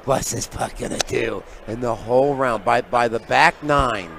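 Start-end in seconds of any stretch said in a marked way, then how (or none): 0.0-1.4 Jack Nicholson. Retold or imitated. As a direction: stretch each word out, slow and amused. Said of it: "looks at me with those joker eyes".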